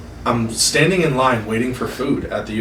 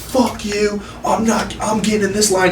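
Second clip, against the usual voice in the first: aggressive, higher-pitched retold outburst; angry yelling; 6 semitones higher than usual. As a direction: snap at her like an angry customer. Angry man cutting in line.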